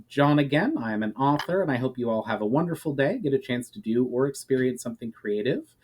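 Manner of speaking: usual speaking voice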